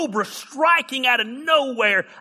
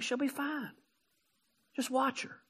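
much softer, settling everyone down; calm reassurance; 15 dB quieter than usual. Retold or imitated. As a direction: hush the panic gently. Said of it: "I'm like".

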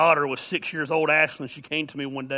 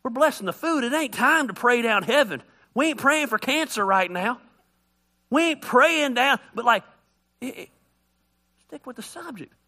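exasperated family; 8 semitones higher, 4 dB louder than usual. Retold or imitated. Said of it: "And they'd be like".

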